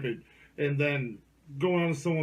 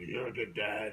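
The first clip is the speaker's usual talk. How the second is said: put-on old man voice; 7 semitones lower, 9 dB quieter than usual. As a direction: speak as a gravel-free old dad. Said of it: "And he'd be like".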